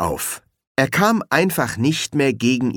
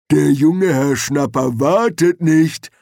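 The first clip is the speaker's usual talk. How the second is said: slow, loud, higher-pitched troll; lumbering troll, louder and dragged out; 6 semitones higher, 5 dB louder, slower than usual. A narrator's reading. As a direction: repeat it ponderously and more insistently, as a troll. Troll repeats his message.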